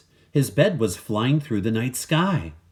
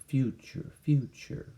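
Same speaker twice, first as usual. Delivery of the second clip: fading softer echo of future; 4 semitones higher, 6 dB quieter, slower than usual. Acted. Narrator echoes the word future, softer and fading. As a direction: let the word echo away.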